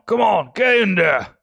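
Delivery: low growling shout; growled warning. Acted. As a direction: bark it like a brutish slaver. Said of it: "a low, growling voice".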